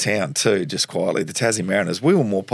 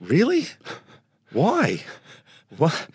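high, incredulous questions; disbelieving dad; 7 semitones higher, slower than usual. Retold or imitated. Dad hearing his son was picked, high and disbelieving. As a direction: play Dad, stunned on the phone. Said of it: "and he said".

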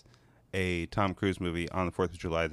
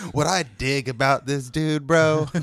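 mocking imitation; 5 semitones higher, 4 dB louder, slower than usual. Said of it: "mimicking Hector's intro".

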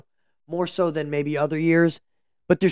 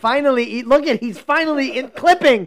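high, loud, heckling commentary; loud mock amazement; 10 semitones higher, 5 dB louder than usual. Real, not acted. Host teasing co-host for finally being audible, loud high heckling. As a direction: crow over him at full volume.

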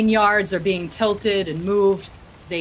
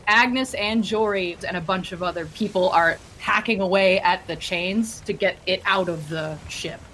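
ordinary talk

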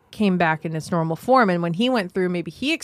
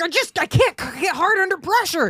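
high, strained whiny plea; man pleading to cops, high and whiny; mock panicked excuse; 10 semitones higher than usual. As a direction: whine it out, voice pitched way up.

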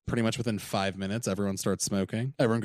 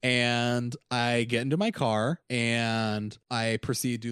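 usual speaking voice

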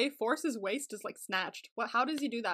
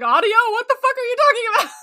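mock outrage; 9 semitones higher, 10 dB louder, faster than usual. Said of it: "He's like".

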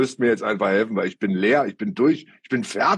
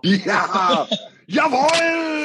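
excited shout; 13 semitones higher, much slower than usual. Real, not acted. Celebrating win, new hashtag.

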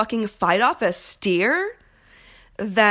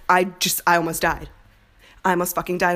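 ordinary talk, nothing marked

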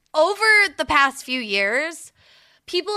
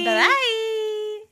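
high sing-song; 11 semitones higher, 3 dB louder than usual. Real, not acted.

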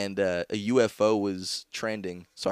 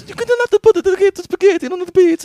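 put-on shrill laughing Italian accent, much higher and louder; over-the-top Italian accent while laughing; 11 dB louder than usual. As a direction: squeal it like a cartoon Italian. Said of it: "Italian accent".

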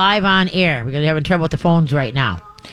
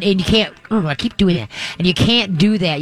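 ordinary delivery

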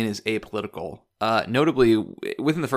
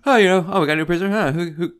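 higher-pitched, put-on yawny indifference; mock disinterested yawning; 8 semitones higher, 5 dB louder, faster than usual. Prisoner shrugging off a new arrival, mock disinterested. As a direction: imitate someone yawning through news they don't care about. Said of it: "Melina seems so disinterested in this update. He's, like, yawning".

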